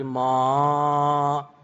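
ما drawn out with pitch gradually climbing; long ما on an upward glide; rising tone. Shown as a demonstration. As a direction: stretch ما and let it rise. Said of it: "یه جا افزایشی صحبت کنی".

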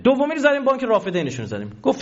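usual speaking voice

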